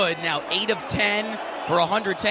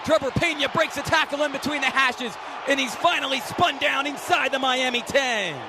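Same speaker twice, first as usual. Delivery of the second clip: higher-pitched, urgent play-by-play; raised excited sportscaster voice; excited run call; 6 semitones higher than usual. Real, not acted. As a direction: call a tackle-breaking run as it happens. Live call of a breakaway run.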